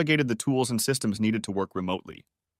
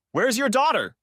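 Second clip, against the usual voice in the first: high, urgent shout; 13 semitones higher, 3 dB louder than usual. A narrator's reading. Father shouting at his wife for their daughter, loud. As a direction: demand it loudly. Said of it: "shouted Al".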